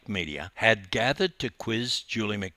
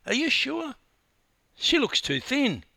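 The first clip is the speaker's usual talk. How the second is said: high-pitched doubting question; incredulous; 4 dB louder than usual. A narrator's reading. Husband doubting his wife at the airport, incredulous. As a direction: query it in high disbelief.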